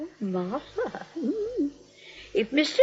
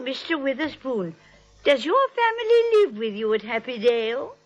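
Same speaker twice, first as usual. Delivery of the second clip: normal talking voice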